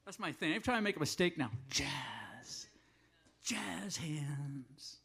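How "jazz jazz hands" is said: hushed, whispered showbiz aside; voiceless stage whisper; playful whisper. Pretend. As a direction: whisper it softly as a running gag. Joking mock-reverent chant after a mistake.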